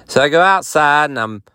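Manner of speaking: ordinary talk, nothing marked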